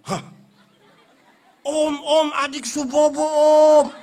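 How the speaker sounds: high, loud staged alarm; fake surprise. Mother pretending to be startled, calling loudly to the bogeyman. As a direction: play startled for the child's benefit. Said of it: "Dia pu mama sengaja kaget".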